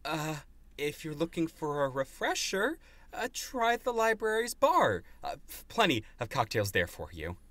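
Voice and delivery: high, strained polite servant; fussy servant voice climbing high on the suggestion; placating servant. Acted. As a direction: steer a drunk guest away politely. GM voices servant redirecting drunk guest.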